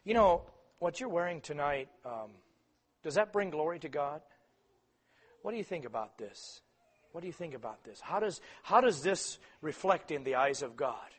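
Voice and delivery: soft, calm questioning; gentle parent. Retold or imitated. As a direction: ask the child gently. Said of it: "sit down with your child and say".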